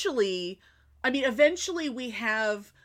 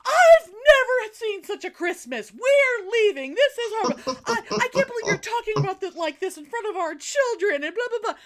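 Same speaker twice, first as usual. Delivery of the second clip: much higher, hammed-up hysterical family; mocking relatives' outcry in a much higher voice; mock yelling relatives; 12 semitones higher, 4 dB louder than usual. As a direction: parody the shrill outrage, half laughing. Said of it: "everyone starts yelling".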